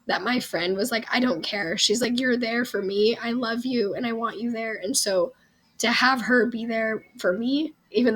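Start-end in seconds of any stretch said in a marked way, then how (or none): none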